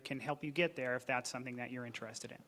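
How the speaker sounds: ordinary delivery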